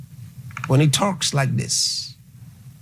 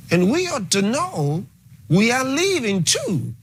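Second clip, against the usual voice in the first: climbing higher into preaching fervor; rising fervor; 8 semitones higher than usual. Real, not acted. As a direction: build up, end high on world. Preacher building to climax.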